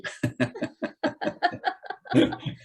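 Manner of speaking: breathless high squeal of laughter; a real wheezing giggle. Real, not acted. Laughing at his own story.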